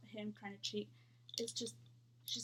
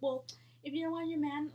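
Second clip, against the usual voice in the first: loud; 4 semitones higher, 7 dB louder than usual. Retold or imitated. Roommate's retort, retold.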